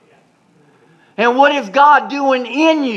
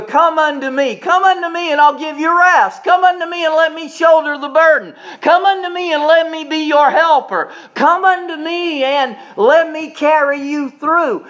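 raised, pleading preacher's refrain; preaching the Lord's call; 7 semitones higher than usual. Acted. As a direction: build the come-unto-me refrain like a preacher. Preacher voices the Lord's invitation.

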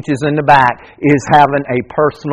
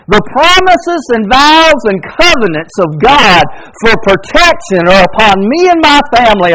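raised, ringing reading of a psalm; scripture reading; 8 semitones higher, 4 dB louder than usual. Acted. Preacher reading a psalm to his congregation, ringing proclamation. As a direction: proclaim the verse to the church.

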